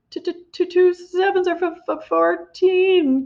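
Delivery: high, stammered gasping answer; boy gasps Two sevens are fourteen; gasped. A narrator's reading. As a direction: force out the right sum in terror. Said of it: "gasped Rupert".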